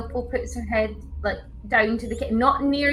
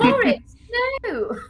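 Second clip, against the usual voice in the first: scolding; 10 semitones higher than usual. Acted. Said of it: "Opal just looks at him and goes".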